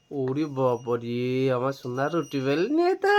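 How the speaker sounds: low, slow playful awe, rising at the end; hushed mock wonder swooping up on the last word; mock amazement. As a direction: marvel low and drawn out for a baby. Mock amazement at baby's rolling pin.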